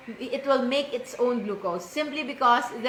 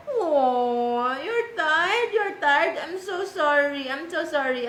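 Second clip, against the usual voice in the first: higher sing-song sympathy; 3 semitones higher, slower than usual. Real, not acted. Soothing the tired child.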